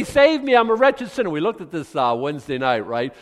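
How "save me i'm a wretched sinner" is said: loud, high cry for salvation; acted plea, raised and urgent; impassioned plea. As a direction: cry out like a desperate sinner. Voicing a sinner's plea to God.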